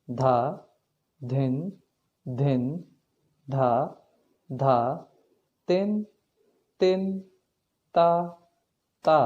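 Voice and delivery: slow, measured bol recitation, one syllable at a time; recited tabla bols. Shown as a demonstration. Teacher reciting teen taal bols to students, steady and measured. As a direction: count out each bol evenly for the student.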